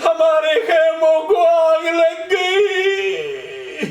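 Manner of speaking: rising wailing majlis recitation; mourning lament. Acted. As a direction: chant the tragedy with grief building to cries. Lamenting the Karbala tragedy. (مجلس میں بلند آواز مرثیہ خوانی)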